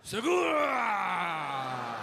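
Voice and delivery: exaggerated Japanese-style drawn-out shout; mock Japanese. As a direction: howl Segura long in a caricatured Japanese accent. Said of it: "you're saying it Japanese".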